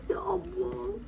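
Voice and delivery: high, breathy whisper; whispered awe. Real, not acted. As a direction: whisper it, overwhelmed. Overwhelmed by the costly prize.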